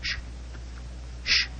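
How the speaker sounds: soft urgent whispering; hushed whisper. Acted. Husband hushes the doctor while eavesdropping on his wife, whispered. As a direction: keep it down so they don't hear. Hiding, overhearing wife on landing.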